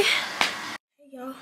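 ordinary delivery

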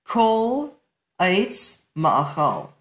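slow, word-by-word Hebrew reading; careful recitation. Shown as a demonstration. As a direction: set each word apart with deliberate pauses. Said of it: "listen carefully".

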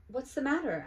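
put-on a mother checking on her child; mother's voice. Mother asking her daughter, lightly voiced.

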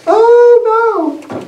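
squeaky, high mock cry; a wife shrieking in hammed-up shock. Preacher mocks the newlywed wife running home: high shocked cry.

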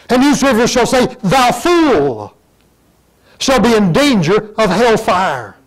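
full-force preaching shout; thundering scripture. Acted. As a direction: proclaim it like a warning from the pulpit. Scripture thundered, then repeated softly.

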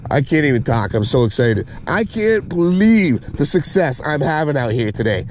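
ordinary talk, nothing marked

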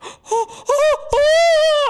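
loud high startled gasp; sharp gasp. Real, not acted. Gamer hit by a scare, loud high gasp. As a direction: gasp in sudden fright.

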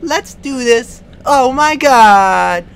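loud, high, slow cheering; excited. Real, not acted. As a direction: whoop with triumph. Thrilled after success.

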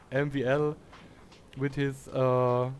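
usual speaking voice

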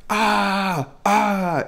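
high, strained cry of pain; a hammed-up wounded yelp; mock pain scream. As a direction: imitate the kid yelling over his leg. Injured juvenile screaming in pain.

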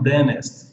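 "dentist" with the middle T dropped, like "dennist"; silent T. Shown as a demonstration. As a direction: say "dentist" leaving the first T mute. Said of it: "com T mudo". ("dentist" com o T mudo)

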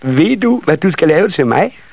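put-on high child voice, eager question; eldest nisse child. A narrator's reading. Eldest child asking carpenter for a present, high and eager. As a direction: ask eagerly in a high little voice. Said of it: "den ældste af nissebørnene".

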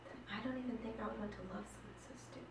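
soft bitter dismissal; deflated quiet. Acted. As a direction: mutter it fast, giving up. Bitter deflated end of confession.

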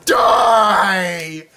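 long, high yelled threat; villainous yell. Acted. Flower attacks the player, drawn-out villain yell. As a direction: hold the word in a high creature yell. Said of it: "I shouldn't have yelled that much".

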